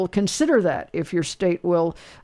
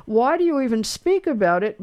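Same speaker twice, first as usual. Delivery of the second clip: normal talking voice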